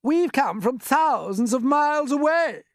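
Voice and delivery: very high, loud, stretched-out holler; shouting back. A narrator's reading. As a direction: yell it down from a great height. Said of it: "the centipede shouted back".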